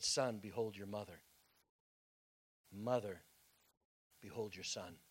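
low, soft and solemn; a dying son's soft farewell; dying words. Acted. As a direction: speak like a dying man, gently. Jesus speaking from the cross.

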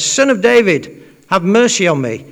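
loud, high cry for mercy; retold shout. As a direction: call out insistently over the crowd. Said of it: "He shouts again".

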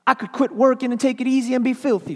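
high, loud furious tirade; angry rant. Acted. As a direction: hurl the grievance upward, furious. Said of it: "she growled".

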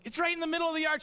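high, loud parent directions; exasperated insisting. Retold or imitated. Dad telling daughter where the rabbit is, exasperated insisting.

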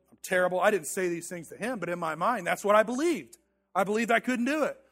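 normal talking voice

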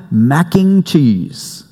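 mac and cheese slowly drawn out, the family way; family pronunciation. Shown as a demonstration. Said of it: "that's what we call it in our house".

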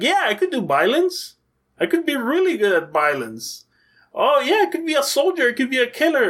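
high, eager, gleeful voice; gushing with enthusiasm for violence. Retold or imitated. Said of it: "she went like".

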